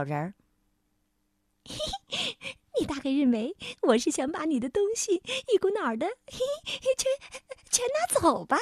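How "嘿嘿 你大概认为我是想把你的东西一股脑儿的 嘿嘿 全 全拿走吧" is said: high, squeaky sly tittering; giggling little creature. A narrator's reading. Little man teases the king about taking his things, giggling squeak. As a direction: giggle between phrases in a tiny voice. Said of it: "小人儿咯咯地笑着".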